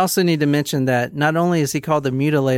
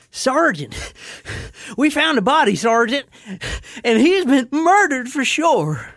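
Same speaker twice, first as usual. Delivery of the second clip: high, out-of-breath Southern soldier; Southern accent; 10 semitones higher than usual. Acted. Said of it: "Just go with Southern".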